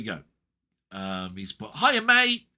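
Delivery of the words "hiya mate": high, loud attempt at a mate's accent; loud, pitched-up go at his accent; Denny's accent. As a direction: belt out a greeting in a badly faked accent of a friend. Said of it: "I can't do his accent".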